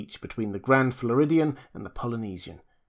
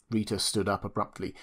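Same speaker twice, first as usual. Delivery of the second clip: plain reading voice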